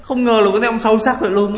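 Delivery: high-pitched, over-the-top astonishment; wide-mouthed amazement. Acted. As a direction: marvel at him in mock disbelief. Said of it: "miệng chữ O, mắt chữ A".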